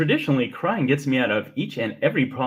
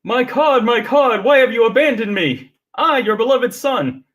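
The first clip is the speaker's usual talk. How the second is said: loud, high, biblical wailing; dramatic lament; 8 semitones higher, 5 dB louder than usual. Pretend. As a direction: cry it out like a martyr.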